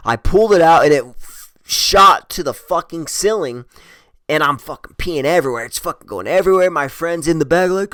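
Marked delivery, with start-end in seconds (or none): none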